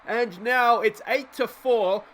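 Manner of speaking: ordinary talk, nothing marked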